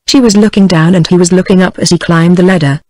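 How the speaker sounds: ordinary delivery, nothing marked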